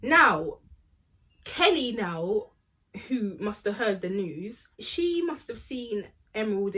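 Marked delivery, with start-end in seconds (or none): none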